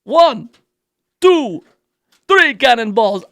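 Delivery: Count von Count. Pretend. Host counting cannonballs as the Sesame Street Count. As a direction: relish each number like a counting vampire. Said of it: "would count them".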